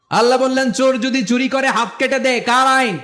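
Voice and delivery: high-pitched declamation; forceful preaching. Real, not acted. Preacher demanding whose law this is, forceful and high.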